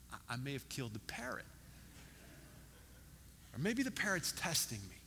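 hushed, hesitant mock worry; worried. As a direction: act out quietly fearing the worst. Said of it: "starts to get worried. She's afraid".

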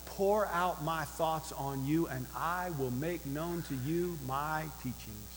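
hushed, unhurried assurance; tender promise. Acted. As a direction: ease down into a gentle offer. Reads Wisdom's promise before her threat.

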